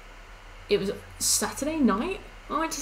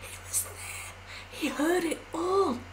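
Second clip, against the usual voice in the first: whispered disbelief; shocked whisper; 8 semitones higher, 5 dB quieter, slower than usual. Retold or imitated. Telling chat her shocked reaction in whisper. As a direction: hush it, scandalised. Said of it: "And I was like".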